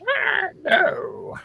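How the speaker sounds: high-pitched pained creature screech; strained high creature wail; screeching goblin. Acted. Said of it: "he screeches".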